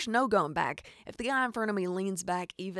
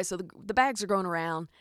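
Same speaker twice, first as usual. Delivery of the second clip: usual speaking voice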